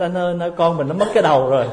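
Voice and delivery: hammed-up wife shouting for her husband; calling out in panic. Wife cries out to husband about the baby, fast mock panic. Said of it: "thì lại la lên".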